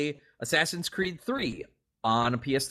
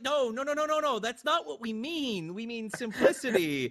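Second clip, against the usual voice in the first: high exasperated mock voice; a flustered marketer correcting his boss; mock marketing exec; 8 semitones higher than usual. Marketing people correcting Miyamoto, high and exasperated. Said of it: "And it's like".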